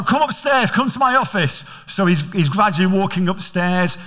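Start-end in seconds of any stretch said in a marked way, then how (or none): 0.0-1.6 stern dad. Retold or imitated. Father orders son upstairs.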